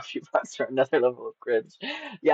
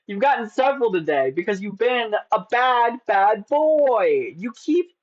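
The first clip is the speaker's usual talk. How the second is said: high, slow teasing scold of a bad boy; mock scolding; 10 semitones higher, 4 dB louder, slower than usual. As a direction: scold him like a naughty child, playfully. Scolding the bot playfully.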